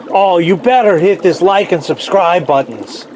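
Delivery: excited mock Russian accent. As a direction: plug the channel in a put-on Russian accent. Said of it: "the best of my Russian accent".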